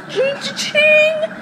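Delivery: high, slow chiming "ching, ching"; coin ching sound. Retold or imitated. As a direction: imitate a game pickup chime. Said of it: "then it goes".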